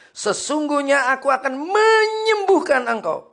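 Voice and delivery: loud, high scripture reading; full-force preaching. Acted. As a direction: proclaim it to the congregation. (membaca ayat dengan suara khotbah lantang)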